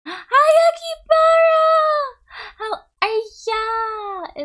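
very high, loud squeal of hello; excited greeting. Real, not acted. Streamer greeting a friend who just arrived, excited high squeal. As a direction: squeal the name out, drawn long.

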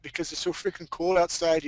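ordinary talk

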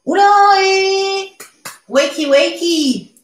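high, loud singsong call to wake the class. Real, not acted. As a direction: sing it out to rouse sleepy students. Teacher calls class awake. (appel chantant et aigu pour réveiller la classe)

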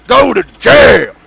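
slow shouted mock verdict; shouted decree. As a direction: bellow the sentence. Sending customer to jail.

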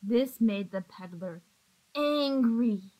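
plain reading voice

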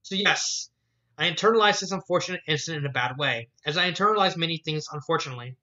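ordinary delivery

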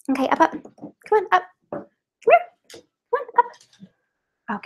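high, soft sing-song dog coaxing; urging her dog Lucy onto the dough, high and cooing. Real, not acted.

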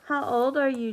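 ordinary talk

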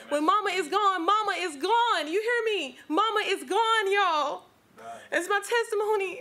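high, loud, fervent preaching with bursts of laughter. Real, not acted. Testifying to the church about death, preaching loudly and high. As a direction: preach it to the congregation at full force.

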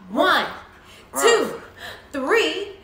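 loud count climbing to a high shout; building to a cheer. Acted. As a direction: count up, then explode into praise.

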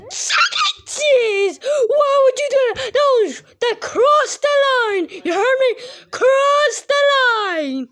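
high, loud, slow furious yell. Acted. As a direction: yell that he crossed the line. Furious confrontation over prank.

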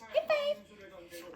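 하이파이브 sung high and slow, falling; high drawn-out command. Shown as a demonstration. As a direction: stretch 하이파이브 high then fall. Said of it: "하이파이브 repeated command".

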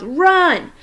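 high, loud urgent yell. A narrator's reading. Boy yelling at the kids to run, loud yell. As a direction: shout it to get them moving.

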